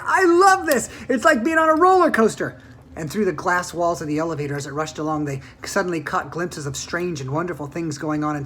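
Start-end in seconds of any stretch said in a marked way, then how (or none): 0.1-2.6 high, loud thrilled shout. A narrator's reading. Charlie clinging to Grandpa Joe's legs, shouting with joy. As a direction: yell it with pure delight. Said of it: "Charlie shouted".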